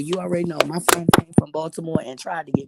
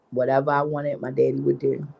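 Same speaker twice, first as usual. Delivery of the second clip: usual speaking voice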